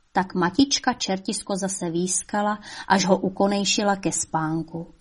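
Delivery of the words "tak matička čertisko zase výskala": usual speaking voice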